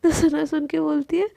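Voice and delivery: usual speaking voice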